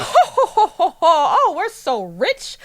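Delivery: high, hammed-up hoity-toity chortle; mock rich snob. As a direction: parody a pampered rich man laughing grandly. Mocking the rich father's airs.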